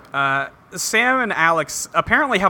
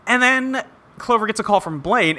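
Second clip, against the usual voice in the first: normal talking voice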